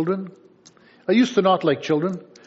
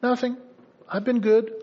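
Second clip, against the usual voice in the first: a higher, lightly voiced kid protesting; innocent child; 6 semitones higher than usual. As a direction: play the little angel.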